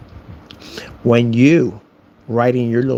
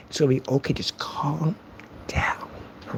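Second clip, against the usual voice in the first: hushed fake reassurance; mock-calming whisper; 11 dB quieter than usual. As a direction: whisper down a ranting gamer. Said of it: "rage screamer in Call of Duty and they're ranting".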